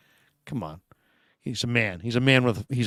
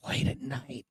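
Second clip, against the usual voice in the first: unvoiced hushed whisper; 14 dB quieter than usual. Shown as a demonstration. Host imitating a caller talking late at night, whispering. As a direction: whisper it like someone might wake. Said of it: "he'll be talking like this".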